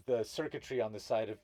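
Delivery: ordinary delivery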